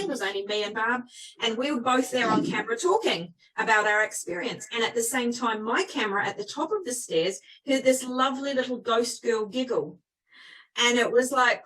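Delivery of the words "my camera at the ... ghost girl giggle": ordinary talk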